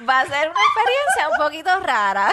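laughing through the explanation. Real, not acted. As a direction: breaks into laughter. Doctor cracks up mid-explanation.

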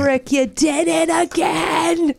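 put-on high, slow, joyful Boston accent; overjoyed Boston broadcaster impression; Susan Waldman. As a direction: crow it in a thick Boston accent. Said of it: "just that accent".

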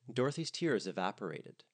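ordinary delivery, nothing marked

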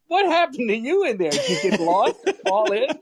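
usual speaking voice

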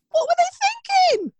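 very high-pitched disbelief; incredulous. Real, not acted. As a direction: squeal it in comic outrage. Incredulous about the soap name.

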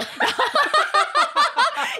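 sudden high squeal of a laugh; shrieking laugh that rises at the end; high burst of laughter. Real, not acted. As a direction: let a high giggle burst out. Laughing at her own joke.

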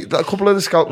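ordinary delivery

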